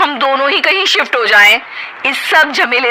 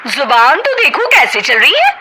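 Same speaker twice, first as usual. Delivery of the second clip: heated quarrel; 9 semitones higher than usual. Acted. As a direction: snap back and forth. Sisters-in-law quarrel.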